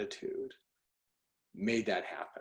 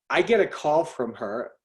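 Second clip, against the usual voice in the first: normal talking voice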